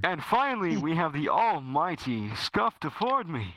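big, swooping announcer voice; grand host introduction. Acted. Host presenting the almighty final guest, grand announcer voice.

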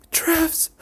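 sharp high cry of his name; a high cry of disbelief; shocked cry. Acted. Júlia cries out in shock.

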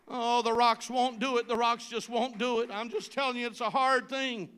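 steady preacher's emphasis; firm preaching. Acted. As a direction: press the point home. Preaches, louder than plain.

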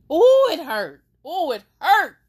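high, loud pained wail of hurt; pained cry. Real, not acted. Venting her pain to the camera, high pained wail. Emotional vlog about being hurt.

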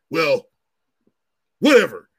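loud mock huff of a cornered officer; dismissive. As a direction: imitate her brushing it off loudly. Officer caught out, dismissive reply.

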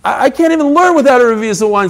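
a touch of pleading urgency; mock Rav Nachman. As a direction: rush it like a sage who can't learn dry. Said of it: "says Rav Nachman".

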